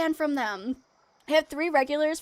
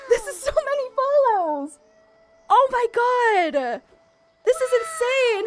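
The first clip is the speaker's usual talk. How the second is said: high-pitched, thrilled amazement; overwhelmed disbelief; 7 semitones higher than usual. Real, not acted. Streamer amazed by so many follows, overwhelmed and high. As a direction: squeal it in real astonishment. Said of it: "I might actually cry".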